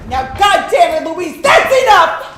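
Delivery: loud furious command; angry shout. Acted. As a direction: shout her down in anger. Father shouting at daughter.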